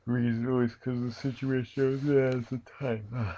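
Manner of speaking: talking through a yawn; words stretched by a yawn. Real, not acted. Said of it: "Sorry for yawning".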